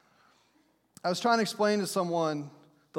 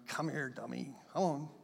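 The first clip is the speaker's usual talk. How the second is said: quiet, low fond coaxing; tender parent calling softly; 6 semitones lower, 8 dB quieter than usual. Acted. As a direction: call the little one gently.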